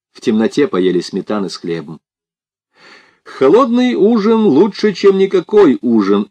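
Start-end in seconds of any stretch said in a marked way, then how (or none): 3.2-6.3 high, sing-out proclamation; pronouncing. A narrator's reading. Boris pronounces on the cold supper, high and sententious. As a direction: deliver it like a grand maxim, pitched high. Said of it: "изрёк Борис".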